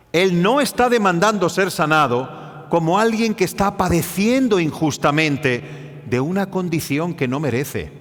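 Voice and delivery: ordinary talk, nothing marked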